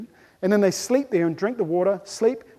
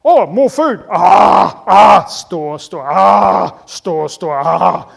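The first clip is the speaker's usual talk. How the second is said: loud gobbling between words; munch noisily as you grab more; greedy gorging; 2 semitones lower, 10 dB louder, much slower than usual. Acted. Human stuffing in more food, loud greedy gobbling.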